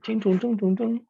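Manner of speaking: high, loud sing-song chin tun tun; playful high-pitched mock Chinese syllables; mock singsong Chinese. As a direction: imitate how the tones sounded as a kid, up high. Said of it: "un idioma que suena más como".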